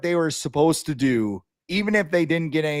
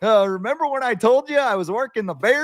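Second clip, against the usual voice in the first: higher-pitched sideline guy bragging; excited storyteller; 7 semitones higher than usual. Acted. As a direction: play a guy eager to tell his boss. Acted sideline guy telling his story.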